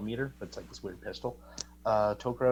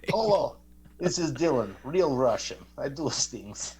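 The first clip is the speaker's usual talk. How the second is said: put-on hammy Russian accent, louder and higher at the start; jokey fake Russian voice; mock Russian accent; 3 semitones higher, 4 dB louder, slower than usual. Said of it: "the accent that you were doing".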